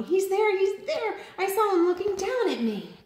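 very high, loud frightened cry; panicked cry. A narrator's reading. Little monster runs to mommy, frightened.

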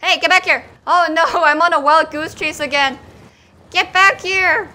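loud calls after a runaway; exasperated shouting. Real, not acted. Player on a wild goose chase, exasperated shouting. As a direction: holler for them to come back.

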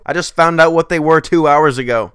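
ordinary delivery

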